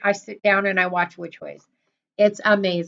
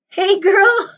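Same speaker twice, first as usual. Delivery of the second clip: laughing greeting; 10 semitones higher, 4 dB louder than usual. Real, not acted.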